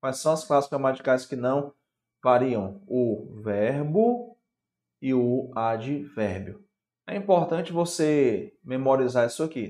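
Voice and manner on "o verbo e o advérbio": slow deliberate answer. Shown as a demonstration. Answering quiz question slowly.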